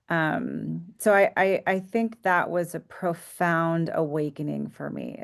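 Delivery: normal talking voice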